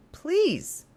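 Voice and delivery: high, cracking desperate plea; pleading with him through a breaking voice. Acted. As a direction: let the word break as you beg. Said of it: "my voice broke, trembling".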